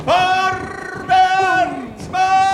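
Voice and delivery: high, loud shouted order; battle command. Acted. Director orders cavalry charge, shouting high and loud.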